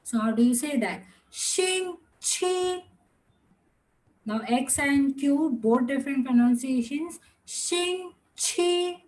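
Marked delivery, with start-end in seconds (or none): 1.3-2.8 星期 drawn out high, syllable by syllable; tone demonstration. Shown as a demonstration. Teacher shows class how to say it, careful tone demonstration. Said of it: "the last tone is the rising tone". 7.4-9.0 星期 high and sustained, syllables apart; tone demonstration. Shown as a demonstration. Teacher shows class X and Q sounds, careful tone demonstration. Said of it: "It's going up".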